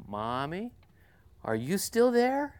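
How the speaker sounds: put-on a child checking that mommy is near; little girl's voice. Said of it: "this little voice comes from that bunk".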